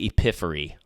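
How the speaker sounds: "epiphany" said as "epiffery"; epiphany mispronounced. Retold or imitated. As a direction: swap the N in "epiphany" for an R. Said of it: "Michael Scott says epiphany".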